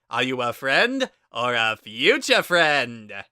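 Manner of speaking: the great Papyrus bellowing into the phone; kazoo yodel voice. Acted. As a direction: shout it like a proud showman. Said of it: "like a kazoo attempting to yodel".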